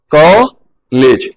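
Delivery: कॉलेज very slow, vowel gliding आ to ओ; drawn-out vowel. Shown as a demonstration. Teacher modeling कॉलेज for students, slow and stretched. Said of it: "ऐसे बोलना है".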